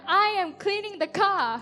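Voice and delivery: with conviction. Retold or imitated. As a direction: announce it boldly, as if fully justified. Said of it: "I said it with conviction".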